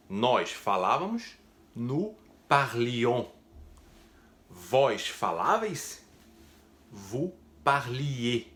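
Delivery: nous parlions very slow, nasal ion at the end; drawn-out nous parlions, vous parliez; slow French pronunciation. Shown as a demonstration. (pronúncia lenta de nous parlions)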